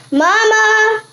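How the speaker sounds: loud call to mom. Real, not acted. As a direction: call out across the house. Calling her mom.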